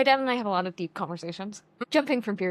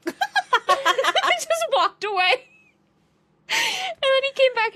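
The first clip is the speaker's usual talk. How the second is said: words breaking into laughter, much higher and louder; laughing; 6 dB louder, much slower than usual. Real, not acted. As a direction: laugh through it, slowly. Laughing while telling dad story.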